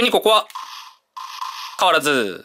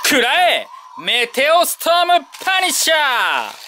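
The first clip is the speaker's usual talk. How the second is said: shouted hero finisher call; screaming attack name; 7 semitones higher than usual. Pretend. Toy review, performing finisher call.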